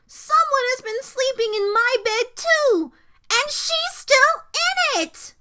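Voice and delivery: put-on high, loud childish yell; hollering Baby Bear. A narrator's reading. Baby Bear discovering Goldilocks in bed, hollering. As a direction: yell it high with growing excitement. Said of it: "hollered Baby Bear".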